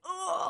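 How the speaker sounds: high, shouted "Oh" of a heart bursting open; a big, startled "Oh" like the Grinch's heart growing; overwhelmed high cry of feeling; Grinch heart-swell gasp. Retold or imitated. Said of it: "like the Grinch at the end of the movie".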